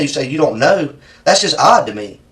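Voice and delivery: ordinary talk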